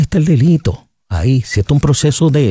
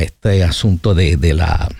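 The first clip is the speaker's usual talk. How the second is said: ordinary talk, nothing marked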